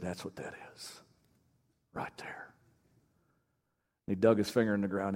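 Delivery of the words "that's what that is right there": near-whisper, low and reverent; barely voiced, solemn Southern murmur; hushed Southern drawl. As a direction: imitate a soft-spoken Southern mentor whispering. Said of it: "Southern drawl".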